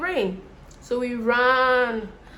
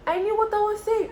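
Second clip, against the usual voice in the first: much higher, insistent; emphatic repeat; 12 semitones higher than usual. Retold or imitated. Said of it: "repeated higher for emphasis".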